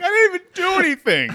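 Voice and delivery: high, fast squeak of protest; wounded yelp of protest; indignant protest. Retold or imitated. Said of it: "It's like".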